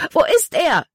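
high, loud frantic cry; frantic scream. A narrator's reading. Libby screams out, searching the water in panic. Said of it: "schrie sie".

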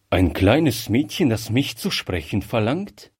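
ordinary delivery, nothing marked

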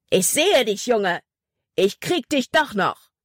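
a villain roaring threats across the river; angry bellow. A narrator's reading. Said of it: "Seine wütende Stimme brüllte über das Wasser". (wütend brüllend, höher)

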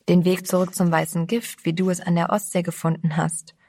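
usual speaking voice

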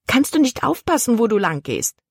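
sharp angry question, pitch jumping high at the start; a touch of a hissed rebuke; mild hiss. A narrator's reading. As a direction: snap at the boy who soaked her dress, pitched high. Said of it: "zischte sie".

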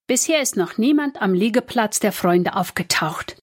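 usual speaking voice

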